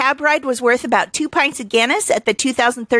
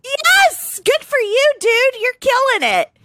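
high-pitched, excited cheer; delighted cheering; 13 semitones higher, slower than usual. Real, not acted. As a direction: hype him up in a high, thrilled voice.

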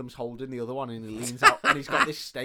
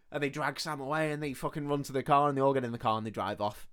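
usual speaking voice

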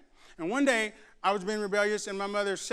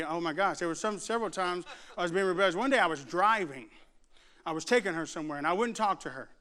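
normal talking voice

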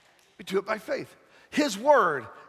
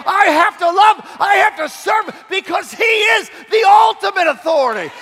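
high, loud shouted preaching; booming motivational speech; 7 semitones higher, 7 dB louder than usual. Acted. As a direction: preach it at full force. Preaching at full force.

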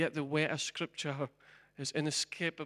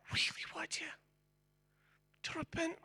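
soft, breathy-free unvoiced whisper, much softer; God's whisper; 13 semitones higher, 6 dB quieter than usual. God speaking tenderly to the lost soul, hushed whisper. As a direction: whisper it like a grieving father. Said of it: "And God said".

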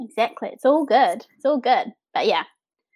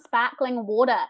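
"sparkling water" with New Zealand r-less vowels; New Zealand accent. Shown as a demonstration. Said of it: "saying it in your accent".

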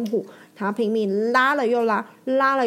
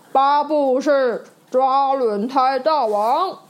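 slow, louder proclamation; booming announcement; 3 semitones higher, 5 dB louder, slower than usual. Acted. Judge announcing Bob's win to everyone, booming. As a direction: announce the champion to the crowd. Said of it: "他用洪亮的声音向大家说".

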